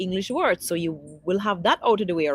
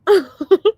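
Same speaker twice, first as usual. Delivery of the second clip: laughing; 10 semitones higher, 9 dB louder than usual. Real, not acted. Laughing at the chat.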